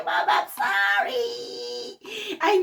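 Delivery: high, drawn-out fake wail; hammed-up tearful apology to mom; mock crying. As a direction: wail it slowly like a pretend sob.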